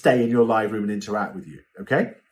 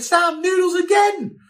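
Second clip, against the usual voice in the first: high, slow, mock-shouted complaint; mock exasperation; 3 dB louder, slower than usual. Host teasing assistant about noodles, high, slow mock shout. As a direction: drag it out in fake disbelief. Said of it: "And I'm like".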